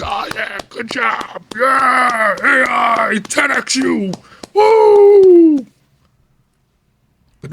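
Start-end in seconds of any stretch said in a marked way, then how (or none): 0.0-5.7 high hype-crowd voice building to a loud whoop; over-the-top fake celebration, pitched way up; mock cheering. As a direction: sarcastically cheer them on, louder and louder. Acts out teams cheering on AI.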